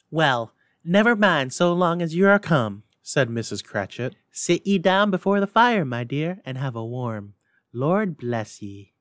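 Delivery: mother settling daughter by the fire; fussing mother. Acted. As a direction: fuss over her, then soften.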